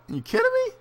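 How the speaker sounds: high, disbelieving outburst; incredulous. Real, not acted. Fan outraged that Belfort gets the belt shot, incredulous. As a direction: throw the line up high in disbelief.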